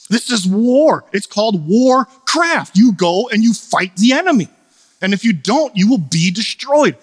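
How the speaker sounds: rally the congregation to battle; forceful preaching. Real, not acted. Preaching climax, full force.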